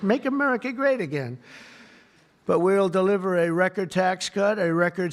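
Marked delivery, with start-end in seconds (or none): 0.0-1.4 higher mock-angry outburst; a hammed-up angry Biden. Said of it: "He was so angry".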